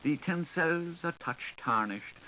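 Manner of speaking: plain reading voice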